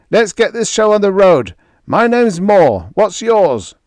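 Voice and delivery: jovial giant shouting introductions; hearty sailor Moore. A narrator's reading. Crewman greets groggy Greg, hearty and loud.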